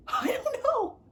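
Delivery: high, squeaky helpless whine; exasperated wail. Real, not acted. Torn over reading the series, high exasperated wail. Answers own question, distressed.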